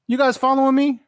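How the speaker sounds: high-pitched, incredulous-sounding question; mock accusing. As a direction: play-act catching someone tailing you. Retold mock accusation to two fans.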